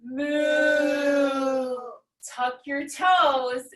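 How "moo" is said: a playful moo called out loud; called-out cow moo. Shown as a demonstration. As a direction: shout a big moo for the kids. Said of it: "back through to cow".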